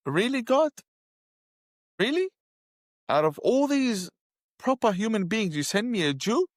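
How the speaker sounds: high, incredulous protest to God; outraged disbelief. Retold or imitated. Man protesting to God in disbelief, high and incredulous. Retelling his outraged prayer.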